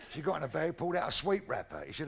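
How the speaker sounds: ordinary talk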